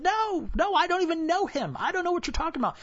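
acted high-pitched, insistent denial; mock panicked denial; nervous denial. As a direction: protest high, rushing the end. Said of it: "He, he said it again".

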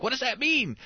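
put-on high, curious little boy question; a light imitation of a confused young son; puzzled kid. Dad imitating his son asking what throwing your voice means, high puzzled kid voice.

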